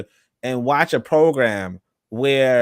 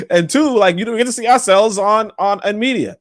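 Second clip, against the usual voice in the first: laughing; 7 semitones higher than usual. Retold or imitated.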